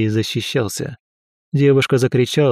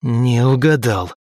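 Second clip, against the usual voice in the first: slow, defiant growl; slower than usual. Acted. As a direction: spit it back at the monster. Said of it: "прорычал я". (прорычал)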